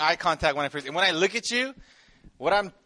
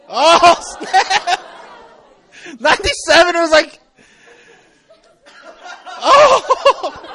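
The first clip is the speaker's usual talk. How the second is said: mock shock; 10 semitones higher, 7 dB louder, much slower than usual. Real, not acted. Mock shock at young ages.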